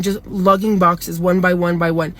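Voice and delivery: ordinary talk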